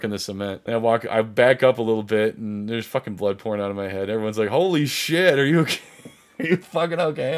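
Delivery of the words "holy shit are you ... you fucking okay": raised, high-pitched alarm; acted-out shocked concern, pitched up; alarmed bystander. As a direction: imitate a panicked onlooker checking on him. Bystanders react to his bleeding head.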